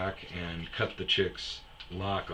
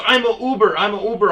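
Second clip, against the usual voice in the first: higher, louder mock protest; whiny driver; 11 semitones higher, 12 dB louder, faster than usual. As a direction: repeat it like a weak excuse. Driver repeating excuse.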